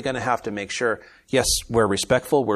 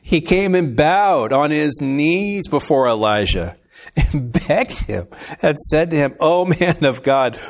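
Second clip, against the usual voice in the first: laughing while reading; 6 semitones higher, 7 dB louder, slower than usual. Real, not acted.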